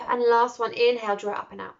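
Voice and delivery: driving breathwork guide; energised breathwork cue. Acted. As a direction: urge one last big breath. Leads a breathing meditation.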